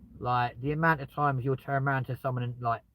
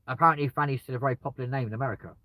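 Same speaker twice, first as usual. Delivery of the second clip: normal talking voice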